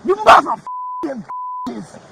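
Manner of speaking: high, loud cursing; furious shout. Real, not acted. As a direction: scream abuse at the officers. Cursing officers while being restrained.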